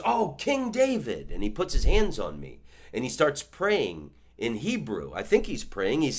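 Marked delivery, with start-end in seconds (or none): 0.0-1.3 put-on high, slow, awed old man; mock reverent "King David". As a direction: lift it up in hammed-up wonder. Old man's delighted remark.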